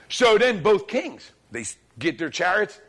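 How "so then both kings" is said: normal talking voice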